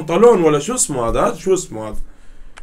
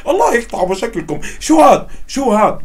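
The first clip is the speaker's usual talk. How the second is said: shocked outburst; 5 semitones higher than usual. Real, not acted. Shocked outburst at ugly skins.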